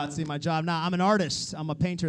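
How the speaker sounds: normal talking voice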